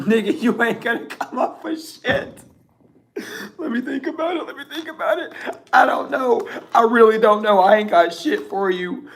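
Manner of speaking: high-pitched, giggling speech; real laughter. Real, not acted. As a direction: squeeze the words out high through laughter. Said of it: "I don't know why I'm laughing so much".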